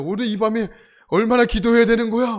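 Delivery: panicking at a long night ahead; startled worry. Retold or imitated. Startled camper wonders how long tonight's prayer lasts, alarmed.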